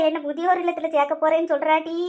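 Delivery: ordinary talk, nothing marked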